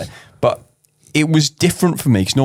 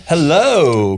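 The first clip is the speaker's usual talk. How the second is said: normal talking voice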